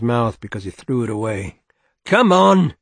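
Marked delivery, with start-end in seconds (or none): 2.0-2.7 sudden loud roar. A narrator's reading. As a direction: bellow it out like a foreman. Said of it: "he roared suddenly".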